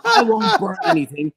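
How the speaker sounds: a cocky retort that breaks into laughter; laughing boast. Retold or imitated.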